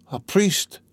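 dying man rasping out a request; husky, high dying plea. A narrator's reading. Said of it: "he articulated huskily".

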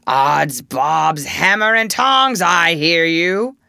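loud, drawn-out pirate captain impression; Hook. A narrator's reading. Peter answers from hiding as the captain, booming. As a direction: boom it out as a mimicked pirate captain. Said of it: "answered in Hook's voice".

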